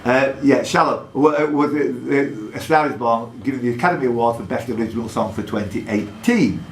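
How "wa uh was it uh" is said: normal talking voice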